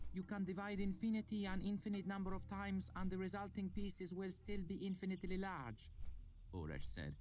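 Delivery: put-on high, careful Lanati accent; student Uresh explaining infinity in a Lanati accent; odd Lanati accent. A narrator's reading. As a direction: reason it out in a foreign Lanati lilt. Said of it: "in his odd Lanati accent".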